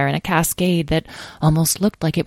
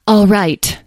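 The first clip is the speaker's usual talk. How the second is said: high, loud, lordly fairy; haughty fairy voice; imperious; 6 semitones higher, 6 dB louder than usual. A narrator's reading. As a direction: concede it grandly. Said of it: "said the one with the imperious tone".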